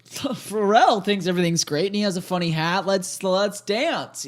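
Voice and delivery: high, hammy cheerful voice; goofy mock voice. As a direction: ham up a fake cheerful official. Mocking government voice.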